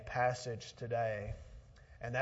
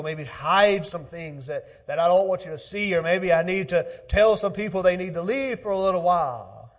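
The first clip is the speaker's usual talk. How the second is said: raised, louder preaching voice; 7 semitones higher, 13 dB louder than usual. Acted. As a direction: preach it out to the congregation. Preaching, voicing a host.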